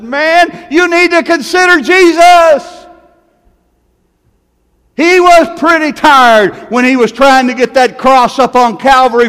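impassioned pulpit cry; full-force preaching. Acted. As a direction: cry it out like a revival climax. Preaching climax about the cross.

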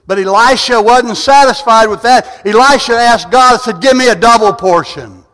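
loud, high, driving sermon voice; full-force preaching. Acted. Preacher rousing the congregation with full-force preaching. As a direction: preach it out, building fire.

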